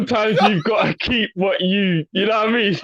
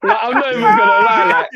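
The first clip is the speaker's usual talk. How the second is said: excited; 6 dB louder than usual. Real, not acted. Excited recall of music.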